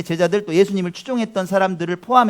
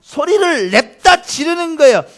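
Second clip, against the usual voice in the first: loud, high, building preacher voice; raised, forceful delivery; emphatic preaching; 8 semitones higher, 9 dB louder than usual. Real, not acted. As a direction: hammer it out to the congregation.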